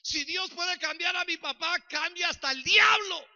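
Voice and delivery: high, loud shout of disbelief; shouted saying. Retold or imitated. Young son shouting his saying about his father. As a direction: bellow the old saying. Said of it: "mira el dicho que yo tenía".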